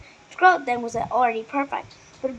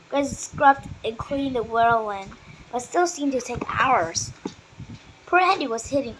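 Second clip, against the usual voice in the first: ordinary delivery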